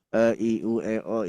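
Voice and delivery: E, I, U, E, O said casually like reading a newspaper; the wrong, non-Quranic vowels. Shown as a demonstration. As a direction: rattle off the vowels lazily. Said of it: "Itu baca koran namanya".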